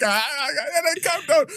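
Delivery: mock shouting. Retelling how partially deaf people talk, mock shouting. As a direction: imitate excitable shouters in a high voice. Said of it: "they're shouting and they're like".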